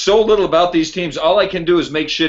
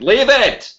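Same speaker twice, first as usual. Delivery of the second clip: sudden higher-pitched yell; 9 semitones higher, 4 dB louder than usual. Real, not acted. Podcaster yelling at co-host not to fix spelling. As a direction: insist with an exasperated burst. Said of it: "Why are you yelling?".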